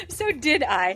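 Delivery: high laughing agreement. Real, not acted. Laughing agreement after listener's outburst.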